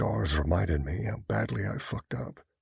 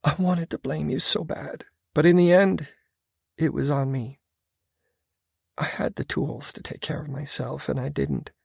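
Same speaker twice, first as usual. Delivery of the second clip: confessing woman; 9 semitones higher than usual. A narrator's reading. Female character confesses.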